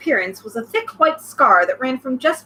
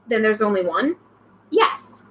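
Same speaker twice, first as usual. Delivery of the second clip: ordinary delivery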